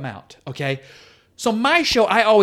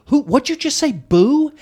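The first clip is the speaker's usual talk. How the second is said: retold confrontation; 9 semitones higher, 4 dB louder than usual. Joe confronts a heckler.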